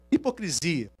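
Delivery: usual speaking voice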